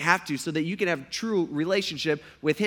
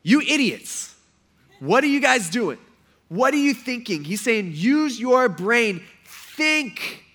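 louder, higher, incredulous telling-off; exasperated scolding; 6 semitones higher, 5 dB louder, slower than usual. Acted. Preacher playing Paul scolding the Galatians, exasperated. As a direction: scold them like a fed-up apostle. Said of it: "Let me just translate that".

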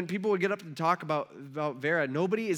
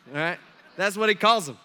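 ordinary delivery

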